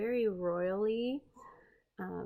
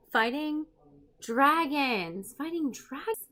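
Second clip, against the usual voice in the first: fighting dragons, loud and higher, word by word; emphatic correction; 5 semitones higher, 6 dB louder than usual. Real, not acted. Rereading the caption she misread, loud and emphatic.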